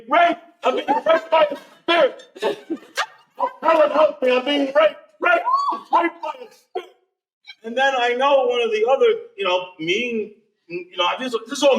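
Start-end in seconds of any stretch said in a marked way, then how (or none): 0.1-6.9 half-laughing mock yell for help; mock scream. Imagined scream at the ghost told to friends, laughing mock yell. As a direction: ham up a cry for help, laughing through it. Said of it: "I'm gonna have to scream".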